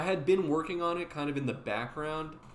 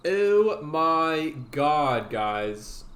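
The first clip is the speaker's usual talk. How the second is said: drawn-out excited; 3 semitones higher, 8 dB louder, much slower than usual. Real, not acted. Greeting chat excitedly.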